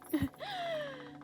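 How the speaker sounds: high, soft menacing chuckle; creepy laugh. Acted. Slaver laughing at the terrified girl, creepy soft chuckle. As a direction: laugh at her fear.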